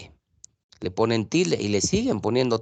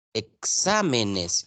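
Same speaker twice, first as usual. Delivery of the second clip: "Exámenes" slow, with a long stressed "xá"; teacher's slow demonstration of "Exámenes"; drawn-out syllables; much slower than usual. Shown as a demonstration. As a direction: stretch out "Exámenes" so the stressed "xá" stands out. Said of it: "ya cambia la figura".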